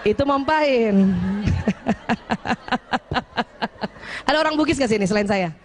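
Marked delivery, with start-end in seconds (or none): 1.0-4.2 long laugh. Real, not acted. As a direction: let the laugh run out. Laughter after the joke. (tawa panjang yang memudar)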